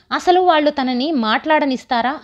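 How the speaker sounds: ordinary delivery, nothing marked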